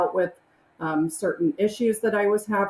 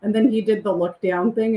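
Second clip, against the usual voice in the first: ordinary talk, nothing marked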